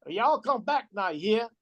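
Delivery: folksy put-on Southern US accent. Shown as a demonstration. Showing how they all talk down South, Southern accent. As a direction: talk like someone from the Kentucky mountains. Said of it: "the other accent is Southern".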